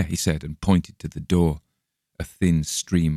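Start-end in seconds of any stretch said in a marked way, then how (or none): none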